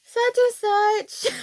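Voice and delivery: high, drawn-out call to sister; a hint of kid calling sis; lightly voiced child calling out. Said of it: "So, like".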